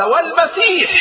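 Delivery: very high, ringing cry; exclaimed vow at a high pitch; triumphant oath. A narrator's reading. Enemy commander swears an oath.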